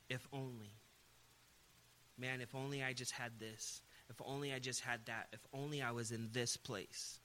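soft, low sighing wishes; a hint of self-pity in each wish; wistful longing. Voicing wistful inner complaints.

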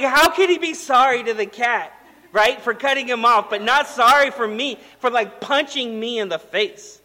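loud, high mock outrage; exasperated disbelief. Father complaining his son apologized only to the cat, exasperated. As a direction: protest the injustice as a baffled, wronged dad.

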